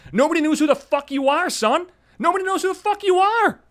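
building real rage; furious yell. Real, not acted. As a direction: scream it in his face. Ranting at the host.

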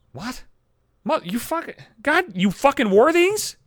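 sputtering high, ending loud and drawn out; incredulous, high-pitched reaction; appalled disbelief. Acted. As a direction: sputter in acted outrage, then burst out. Appalled at hearing earbuds were worn.

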